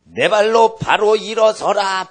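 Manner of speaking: slow, high, ringing shout; loud, drawn-out command pitched high; loud prophetic command. Acted. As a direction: cry it out as Paul commanding. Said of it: "바울이 큰 소리로 외치는 것은".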